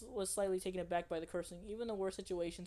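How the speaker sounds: ordinary talk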